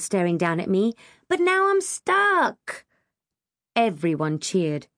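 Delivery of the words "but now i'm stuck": high, drawn-out wail. A narrator's reading. Kim stuck at the top of the ladder, wailing. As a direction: wail it slowly and helplessly. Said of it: "Kim wailed".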